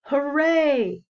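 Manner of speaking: put-on loud, high cheer of children. A narrator's reading. Children cheering that the lion can come back. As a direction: cheer hooray like happy kids. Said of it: "said the children".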